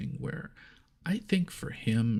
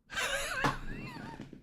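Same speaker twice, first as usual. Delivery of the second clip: real squeaky giggle breaking out; can't keep a straight face, high giggle; real high-pitched laugh. Real, not acted. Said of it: "I couldn't keep a straight face".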